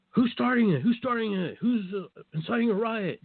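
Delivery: high urgent security guard voice; imitating security. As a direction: demand it like a guard hunting a troublemaker. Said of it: "they're like".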